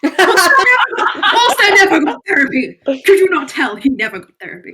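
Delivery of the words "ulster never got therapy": high, slow, laughing outcry; laughing exclamation. Real, not acted. Player exclaims to the table about a character, laughing. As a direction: draw it out high through a laugh.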